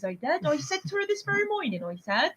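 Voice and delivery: put-on lightly done Bristol accent, chatty and offhand; a hint of a Bristol man casually confessing; Bristol man character. [name] joining in the Bristol killer character for Mike. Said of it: "a character that me and [name] came up with".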